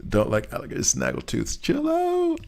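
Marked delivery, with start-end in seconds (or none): 1.6-2.4 very high, squeaky put-on voice; squeaky mock protest.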